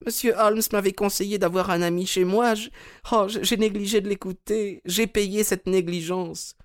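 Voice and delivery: put-on high, sad old lady voice; a frail lady, smiling sadly; Mrs Maberley. A narrator's reading. Mme Maberley à son conseiller Holmes, voix aiguë et triste.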